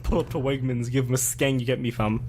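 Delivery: light London roadman voice; London roadman accent. Pretend. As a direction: roadman accent, half joking. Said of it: "my Roadman voice".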